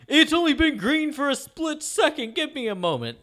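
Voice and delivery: high, slow mocking voice of a dawdling driver; slow driver mocked. Honked-at driver dismissing the impatient car behind, mocking and slow. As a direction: lazily brush off the honk in a put-on voice. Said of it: "And he's like".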